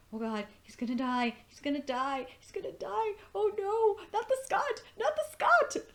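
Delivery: building dread into a shriek; panicked worry. Retold or imitated. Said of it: "I was, like, thinking".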